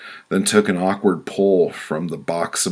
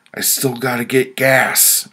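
raised, higher-pitched yell; irritated yell; 7 semitones higher, 5 dB louder than usual. A narrator's reading. Customer at a gas station to a honking truck driver, yelling. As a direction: yell it across at someone, louder and higher. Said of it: "I yelled at him".

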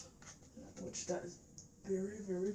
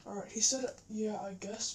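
usual speaking voice

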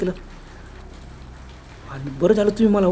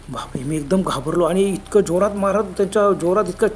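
usual speaking voice

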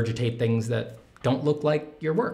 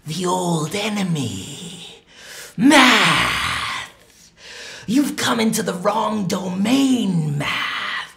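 slow, higher, loud hammy hero confronting math; dramatic villain address; 6 semitones higher, 4 dB louder, much slower than usual. Acted. Artist addresses his old enemy math with theatrical defiance. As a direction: declare war on math like a stage hero.

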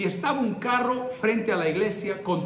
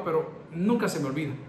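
normal talking voice

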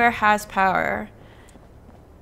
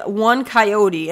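ordinary delivery

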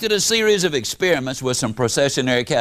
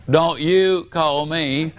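slow brush-off; 2 semitones lower, slower than usual. Retold or imitated. Brush-off line in the note.

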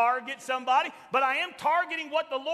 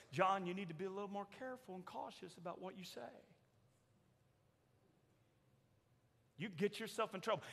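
soft gentle caution, much softer; Chosen-style Jesus; 5 semitones lower, 13 dB quieter, faster than usual. Retold or imitated. Preacher imitating TV Jesus warning John, in a soft gentle voice. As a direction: counsel him gently, almost hushed. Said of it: "this is Jesus in The Chosen".

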